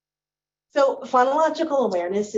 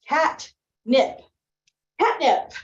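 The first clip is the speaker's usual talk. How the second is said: cat, nip split then joined as catnip, higher; syllable blending; 6 semitones higher, slower than usual. Shown as a demonstration. Kindergarten teacher blending syllables with kids, higher and lively. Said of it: "is we'll say".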